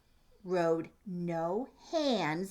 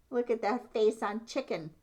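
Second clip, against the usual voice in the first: ordinary delivery, nothing marked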